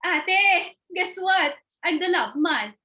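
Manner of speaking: February bragging in a high sister voice. Acted. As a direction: show off to your older sister. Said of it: "That's my other ate, February".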